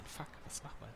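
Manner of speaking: hushed, panicked whisper. Real, not acted. Panic at passport control.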